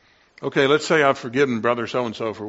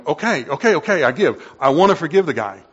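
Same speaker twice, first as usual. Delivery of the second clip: louder pleading "okay", dropping to defeat; exasperated give-in; 2 semitones higher, 6 dB louder than usual. Retold or imitated. A frustrated believer wrestling, louder then defeated. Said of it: "where you're going".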